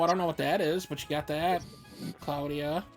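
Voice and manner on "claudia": usual speaking voice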